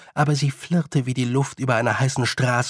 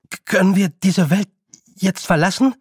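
higher, louder, halting question; flustered stammering boy; 6 semitones higher, 4 dB louder than usual. Acted. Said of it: "sein Gesicht rot". (aufgeregt stotternder Junge)

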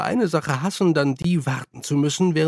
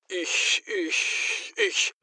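stammelnd; 4 semitones higher, 6 dB quieter, much slower than usual. Acted. Verängstigter Zauberer stammelt.

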